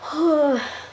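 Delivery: long voiced sigh. Real, not acted. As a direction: let out a drawn sigh. Sighs while playing.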